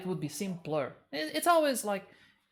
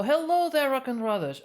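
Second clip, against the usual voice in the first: cheerful greeting; 8 semitones higher, 8 dB louder than usual. Real, not acted. Greeting a chat viewer.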